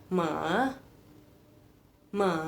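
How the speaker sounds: ma dropping low then coming back up; third tone demo. Shown as a demonstration. Teacher showing students the third tone, dip then rise. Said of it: "Jadi turun dulu, terus naik".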